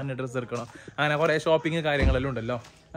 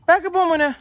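much higher, louder shout of back പോ മോനെ; 7 dB louder than usual. Real, not acted. Calling child to back seat. (ഉറക്കെ ഉച്ചത്തിൽ വിളി)

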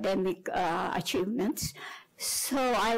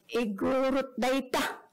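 "Igorot" front-stressed, pitched up; stress on first part; 3 semitones higher than usual. Shown as a demonstration. Lecturer quoting an Ilocano slight, "Igorot" stressed at the front. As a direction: hit the start of "Igorot". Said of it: "with the emphasis on the first word".

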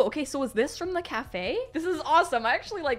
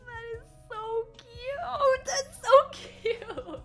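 very slow, high-pitched swooning squeal; real gushing delight; 10 semitones higher, slower than usual. Real, not acted. Fan melting over a surprise keychain, gushing.

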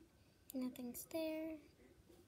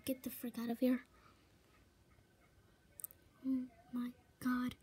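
ordinary talk, nothing marked

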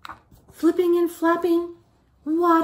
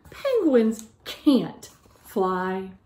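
low, slow, smug bird voice; mocking gull; 7 semitones lower, much slower than usual. Gull teasing Little Penguin, low and slow with mockery. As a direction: drag it out to tease him.

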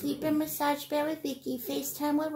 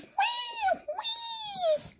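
put-on much higher play baby wail; mock baby cry. Baby toy crying.

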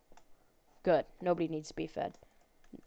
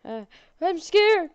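high, loud, slow hammed-up fright; mock scared; 10 semitones higher, 7 dB louder, slower than usual. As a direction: play at being scared, then drop it. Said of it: "I'm joking".

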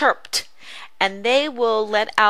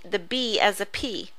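usual speaking voice

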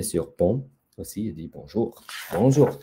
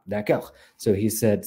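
usual speaking voice

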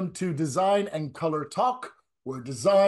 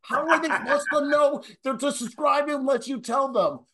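mock Italian New Jersey accent; 8 semitones higher than usual. [name] mimics the tech guy scolding him, in a mock Italian New Jersey accent. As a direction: yell like a hot-headed Italian from New Jersey. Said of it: "is your tech guy, like, an Italian from New Jersey?".

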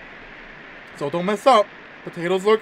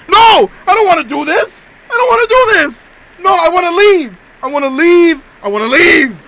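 very high, loud, near tears; crying terrified shout; 11 semitones higher, 10 dB louder than usual. Real, not acted. Gamer crying to escape the quiz game. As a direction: beg to leave in full panic.

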